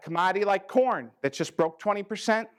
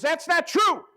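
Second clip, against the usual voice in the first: mock indignant protest, pitched well up and louder; upset protest; 10 semitones higher, 4 dB louder, slower than usual. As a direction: play the offended farmer snapping back. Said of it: "The other farmer got all upset".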